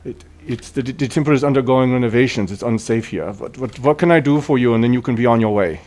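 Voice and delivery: officious temple keeper's set speech; priest Shine Sveinhot. Acted. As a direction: hurry unwanted visitors out, in character. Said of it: "like, a priest of Pelor".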